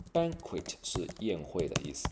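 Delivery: ordinary talk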